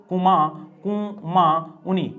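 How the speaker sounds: कुमाऊनी very slowly, in separate pieces; syllable by syllable. Shown as a demonstration. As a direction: break कुमाऊनी into "ku-maa-uni". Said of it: "क्या नाम सुना होगा दोस्तों". (कुमाऊनी को धीरे, तोड़कर बोलें)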